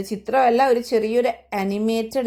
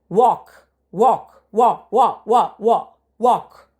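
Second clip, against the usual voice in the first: louder, firm one-word drill; punchy classroom drilling of a single word; loud drill repetition; 5 dB louder than usual. Shown as a demonstration. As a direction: call out the word for the class to repeat. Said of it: "teacher drills the word walk".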